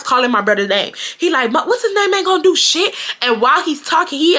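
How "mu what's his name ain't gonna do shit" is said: high mock-taunting yell; retold scream in a raised pitch; imitated screaming. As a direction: imitate a boy jeering, pitched way up. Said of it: "He starts screaming".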